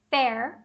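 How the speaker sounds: "fair" said FEHR; open E sound. Shown as a demonstration. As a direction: demonstrate "fair" with an E, not AI. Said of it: "qual que tem o E". ("fair" com som de E)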